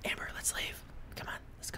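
hushed, voiceless whisper; conspiratorial whisper. Pretend. Player urges Amber to sneak off, whispering. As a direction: whisper it like a secret escape plan.

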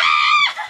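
loud high laughing shriek; screaming laugh from the cold. Real, not acted. Sitting down in ice water.

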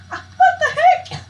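high, loud, drawn-out laughing squeal; laughing disbelief. Real, not acted. Gamer startled by the game, laughing disbelief. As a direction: squeal it through real laughter.

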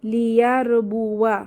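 slow, drawn-out recited word; Quran recitation demo. Shown as a demonstration. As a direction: recite it slowly, Quran style. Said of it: "আমরা হচ্ছে কি পড়বো?".

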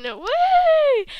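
high, loud whoop; cheer. Pretend. Introducing the boy contestant, a loud cheer. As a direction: whoop like a hyped crowd.

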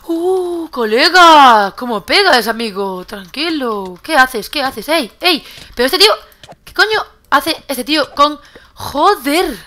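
loud, alarmed outburst at an attacker; high, loud, rattled protest; startled yelling. Real, not acted. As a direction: yell in real shock at being jumped. Attacked suddenly in the game.

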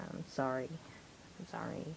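very soft, fading to a whisper; hushed apology. Pretend. Reviewer apologising for the put-on voice, hushed. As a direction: let the apology shrink almost to nothing.

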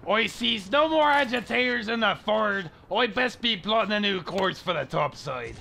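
lightly voiced pirate, farmer-ish West Country. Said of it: "He's a pirate!".

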